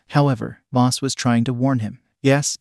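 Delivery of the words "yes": ordinary delivery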